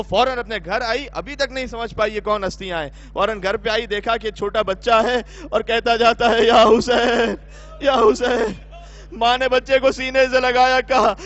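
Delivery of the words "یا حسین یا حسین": put-on child calling out. Child crying out Hussain's name.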